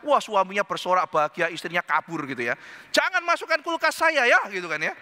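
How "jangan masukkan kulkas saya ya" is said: high-pitched, protesting wife; acted-out refusal, pitched up; indignant objection. Wife forbids jengkol in her fridge, indignant.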